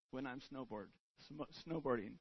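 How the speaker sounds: fighting back tears mid-sentence; choked up. Real, not acted. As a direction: voice breaking, hushed and close to tears. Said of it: "without getting emotional".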